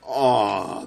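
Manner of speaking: loud drawn-out cry; frustrated groan. Real, not acted. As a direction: groan loudly at the near miss. Narrow miss in game.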